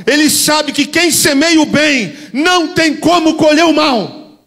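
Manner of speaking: high, loud fiery preaching; preaching at full force. Real, not acted. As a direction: thunder it out at the congregation.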